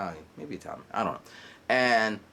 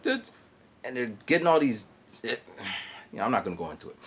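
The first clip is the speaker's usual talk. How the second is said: usual speaking voice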